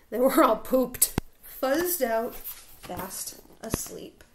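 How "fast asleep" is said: slow, hushed whisper; sleepy whisper. A narrator's reading. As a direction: trail off as if dozing away. Everyone falls asleep.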